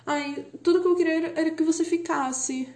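lightly voiced sweet, high lovesick girl; happy singer voice. Vlogger quoting the happy Stay song, sweet and high. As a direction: chirp it like a happy love song. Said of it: "uma música felizinha falando".

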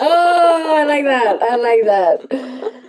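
loud, high burst of delight; light up at the idea. Real, not acted. Reacting to her co-host's "easy love" with a delighted outburst.

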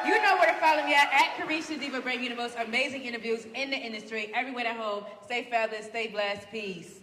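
loud hype host sign-off; talk show host outro. Acted.

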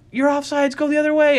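high-pitched sideline holler; coach calling out. Acted. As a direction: call urgently across the field.

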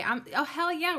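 high, loud burst of glee; excited. Real, not acted. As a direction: pump it up, hell yeah. Cheering on Tarot Tuesday.

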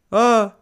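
high shout of alarm; short high yelp for help; retold scream. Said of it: "Eu comecei tipo".

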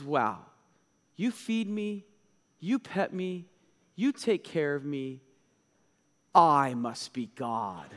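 lightly voiced smug cat, low and slow; a hint of a self-satisfied cat, drawn out at the end. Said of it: "my cat looks up at me and goes".

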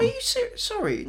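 high, disbelieving squeak; can't believe what she just heard; incredulous. Real, not acted. Friend scoffs at opinion of David Harbour, high incredulous.

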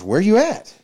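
high, loud where you at; the friend asking loudly where he is; urgent question. Retold or imitated.

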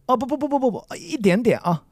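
high fluttering bu-bu-bu, ending on an exclaimed ah; very Chinese refusal. Shown as a demonstration. Teacher demonstrating for the student, very Chinese exaggerated refusal. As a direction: protest politely in a high Chinese-style patter. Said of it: "make it very Chinese".

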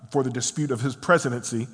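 ordinary delivery

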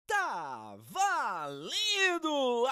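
long, stretched sports shout; kickoff call. Acted. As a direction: call the start of the match.

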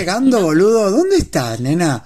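hammed-up porteño voice; mock Argentine. Recreating the old joke voice notes in a hammed-up Argentine accent. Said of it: "haciendo el argentino".

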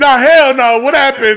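loud, high-pitched disbelief; real shock. Real, not acted. Host hears guest's dad killed somebody, real shock. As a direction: burst in loudly, demanding the story. Host reacts to killing revelation.

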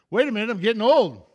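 loud, high jolt of dawning shock; sudden alarmed realization. Acted. Preacher voicing a parent noticing the years have flown, alarmed.

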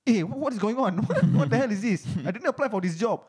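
stammering, high-pitched confusion; bewildered. Retold or imitated. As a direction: act out baffled disbelief, half laughing. Acting out his bewilderment at interview.

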